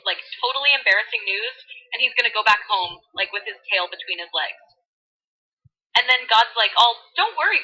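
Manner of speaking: put-on bubbly valley girl voice; valley girl retelling scripture. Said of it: "in full Valley Girl mode".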